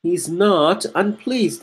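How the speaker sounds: slow, with "not" leaned on in "He's not unpleased"; stress on not. Shown as a demonstration. Teacher stresses the denial for his students. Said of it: "That makes for me much more sense".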